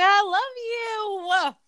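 sappy sing-song I love you; gushy mock love. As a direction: stretch it out high like an overly sweet friend. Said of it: "I'm like".

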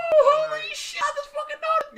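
high-pitched shocked yell; excited shriek. Real, not acted. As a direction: squeal in disbelief. Shrieking realization.

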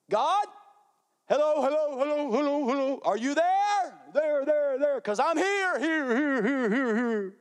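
high, insistent calling to an absent God, fading at the end; a desperate believer calling up to the sky. Acted. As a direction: knock on heaven's door, then trail off. Acted desperate prayer calling out.

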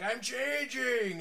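high, long drawn-out mock cry; hammed-up creature-feature wail; changing wolf man. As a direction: stretch it out like a B-movie transformation. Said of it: "some kind of wolf man".

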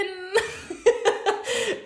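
high, loud burst of laughter. Real, not acted. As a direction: laugh out at yourself. Laughs at her own irony. (lautes, hohes Auflachen)

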